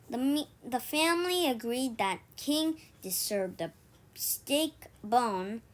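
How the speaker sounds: ordinary delivery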